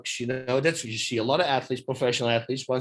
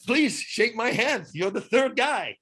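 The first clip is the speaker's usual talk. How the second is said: higher, slow mock-admiring praise; deadpan sarcasm toward the angry driver; sarcastic; 7 semitones higher, slower than usual. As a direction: slowly pretend to be impressed. Mocking driver who never errs.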